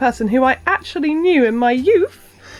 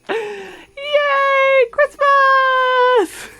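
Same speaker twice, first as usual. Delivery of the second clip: excited cheer; 12 semitones higher, much slower than usual. Real, not acted. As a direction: squeal with joy over the outfit. Cheering for baby's Christmas clothes.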